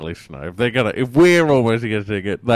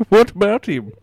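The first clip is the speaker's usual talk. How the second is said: light mock Italian-German mobster accent; a hint of a thick foreign accent, deadpan; put-on Godfather accent; 10 semitones higher than usual. Caller plays a Godfather, mild mock foreign accent. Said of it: "it's probably my accent".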